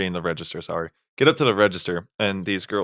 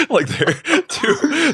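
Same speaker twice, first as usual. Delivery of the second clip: laughing disbelief; 3 dB louder, much slower than usual. Real, not acted. Laughing mock of friend.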